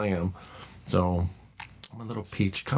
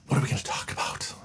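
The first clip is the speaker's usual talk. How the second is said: whisper; faster than usual. Real, not acted. Musing to himself.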